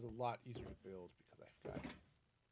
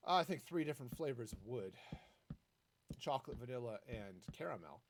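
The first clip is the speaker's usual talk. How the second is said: normal talking voice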